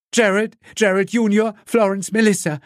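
high cries of a horrified mother; panicked screaming. A narrator's reading. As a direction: cry out for your family, high-pitched. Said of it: "fing an zu schreien". (entsetzt schreiende Mutter, hohe Stimme)

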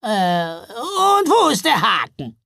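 high, slow, loud troll question; hesitate, then ask with suspicion; wary troll. A narrator's reading. Troll looking for the catch in the offer, wary.

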